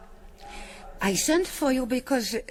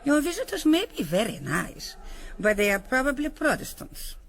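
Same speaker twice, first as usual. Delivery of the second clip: normal talking voice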